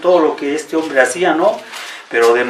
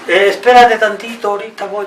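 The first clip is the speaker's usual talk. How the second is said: mock child stalling, higher; 5 semitones higher than usual. Preacher imitates his son stalling when sent on an errand, higher. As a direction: lightly voice a kid putting off his dad, higher. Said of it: "Le digo, "Hijo, ve".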